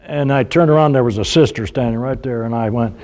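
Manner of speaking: normal talking voice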